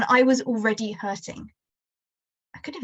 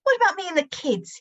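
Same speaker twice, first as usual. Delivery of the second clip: a sharp, much higher demand; angry father; 8 semitones higher than usual. Acted. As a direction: accuse her, voice climbing. Father argues with late mother.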